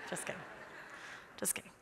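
dropped almost to nothing, playful; a soft, teasing aside to the congregation; quiet joking aside. Real, not acted. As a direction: take it back under your breath, teasing. Joking about giving out mom's number.

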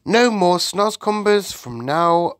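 high, loud, slow declaration; triumphant little Sophie. Acted. Sophie reassures the BFG, triumphant and slow.

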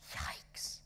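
soft, whispered gasp of dread; hushed fright; alarmed whisper. A narrator's reading. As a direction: whisper it in shock. Boy's dread at the threat.

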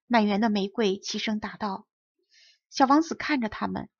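usual speaking voice

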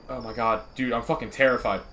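usual speaking voice

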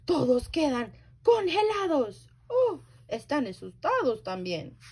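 plain reading voice

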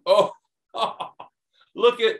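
ordinary talk, nothing marked

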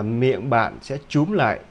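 ordinary talk, nothing marked

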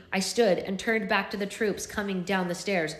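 plain reading voice

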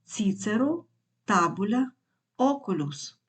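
"Ціцеро, табуля, окулюс" stressed on the first syllable; antepenultimate stress. Shown as a demonstration. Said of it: "Наголос переноситься на третій склад".

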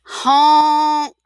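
blaring dump truck horn; loud honk. A narrator's reading. Dump truck honks in story.

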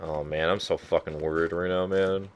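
usual speaking voice

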